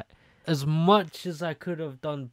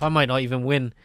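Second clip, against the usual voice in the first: normal talking voice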